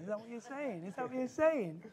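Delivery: playful query rising in pitch; mock questioning. Jokingly voices the congregation's thought.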